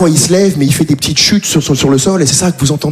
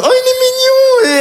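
put-on very high, slow baby-cooing squeal; cooing over baby; slower than usual. Seeing the baby reach for him, squeaky cooing. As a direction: melt at the baby in a squeaky coo. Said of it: "je suis partagé entre".

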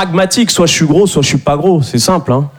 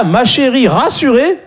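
usual speaking voice

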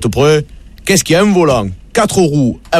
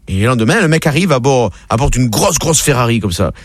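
normal talking voice

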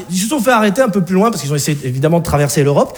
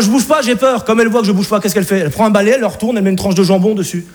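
normal talking voice

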